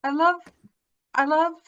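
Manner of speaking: loud, high eager interruption; excited burst. Real, not acted. Host cutting in, excited by a memory, loud. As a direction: blurt it out, suddenly remembering.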